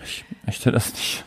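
slow breathy whisper; whispered refusal. Pretend. To the cohost about superstitions, a slow whispered refusal.

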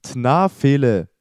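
"Tnafele" read very slowly and loudly; backwards speech. Shown as a demonstration. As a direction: draw "Tnafele" out slow and loud as a backwards word. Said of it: "Mit dem Spiel Wörter rückwärts". (rückwärts gesprochen: "Tnafele", langsam und laut)

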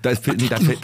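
normal talking voice

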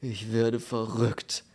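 slow, drawn-out exasperated moan; understated wail of despair; tormented howl. Acted. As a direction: moan as if driven mad. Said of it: "heulte der gemarterte Besitzer".

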